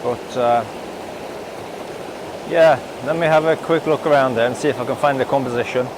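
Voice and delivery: beside rushing river. Real, not acted. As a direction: speak up over the rapids. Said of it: "Hopefully you can hear me over the water here".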